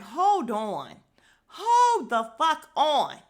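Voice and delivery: loud, drawn-out hold the fuck on; outraged. Real, not acted. Fuming at the confessional, stretched loud outrage. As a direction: stop everything, furious. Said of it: "it pissed me off".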